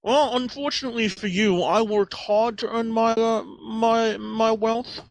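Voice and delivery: high, smug rich snob. Acted. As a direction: sneer like a spoiled aristocrat. Snob character defends wealth.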